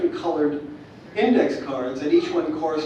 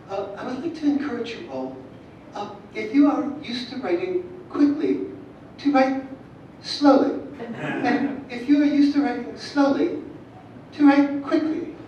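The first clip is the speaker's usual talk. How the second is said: put-on Scottish accent; 9 semitones higher than usual. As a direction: imitate a Scottish lilt, measured. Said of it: "I'm gonna do a Scottish accent".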